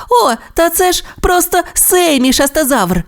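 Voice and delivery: високий радісний вигук; видихни з полегкістю й радістю; вигук Тімі. Acted. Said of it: "вигукнув Тімі". (Тімі впізнає Семі: радісний вигук)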